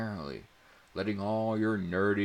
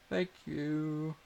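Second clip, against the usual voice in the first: thank you; 11 semitones higher than usual. A narrator's reading. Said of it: "Abby said with a slight smile".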